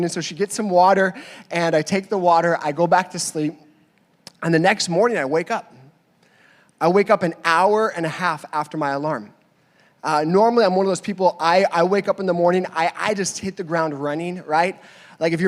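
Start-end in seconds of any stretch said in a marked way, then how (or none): none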